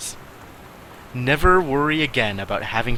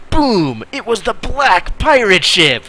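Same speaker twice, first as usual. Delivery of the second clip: higher and louder, big dramatic read; excited storytelling; 10 semitones higher, 7 dB louder, slower than usual. A narrator's reading. Reading his sister's pirate story, excited and loud. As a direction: boom it out like a kid's adventure.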